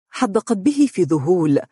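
usual speaking voice

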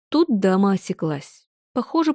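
ordinary delivery

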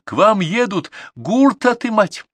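high, loud alarm cry; shouting from afar. A narrator's reading. Girl warns the herders of confiscation, shouting. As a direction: yell the warning across the distance. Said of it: "издали закричала она".

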